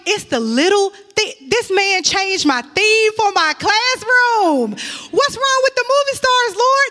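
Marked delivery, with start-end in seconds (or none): 4.7-6.9 much higher, whiny mock protest to the Lord; mock complaint to God. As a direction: squeal it like a spoiled kid asking God why. Complaining to God about theme.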